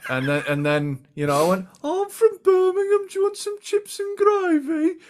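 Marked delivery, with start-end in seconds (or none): 1.8-5.0 put-on high-pitched caricature of a Birmingham accent; mock Birmingham accent. Teasing girlfriend about where she's from, mock Birmingham accent. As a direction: put on a squeaky Brummie voice. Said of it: "She's from Birmingham kind of area".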